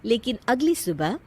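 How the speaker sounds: usual speaking voice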